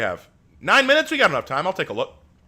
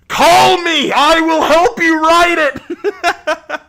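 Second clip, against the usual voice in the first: high, loud, drawn-out eager shout; excited shouting; 13 dB louder, much slower than usual. Real, not acted. Streamer jumping at a viewer's crossover idea, excited shouting. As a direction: yell the offer at full volume, breaking into laughter.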